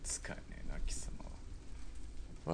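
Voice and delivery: low, unvoiced under-the-breath insult; contemptuous mutter. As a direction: mutter the insult so only he hears. Soldier grumbles at corporal. (小声で吐き捨てるように)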